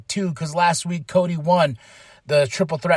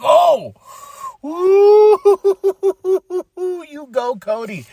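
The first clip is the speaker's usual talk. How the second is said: laughing cheer; 12 semitones higher, 5 dB louder than usual. Retold or imitated. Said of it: "I was like".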